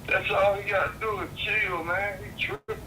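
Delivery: over a landline phone. Real, not acted. Caller heard through phone speaker.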